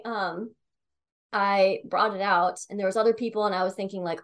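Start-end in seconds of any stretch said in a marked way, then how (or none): none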